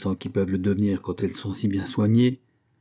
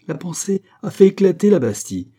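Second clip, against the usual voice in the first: triumphant proclamation; 8 semitones higher, 6 dB louder than usual. Acted. Climax of Cagliostro's speech.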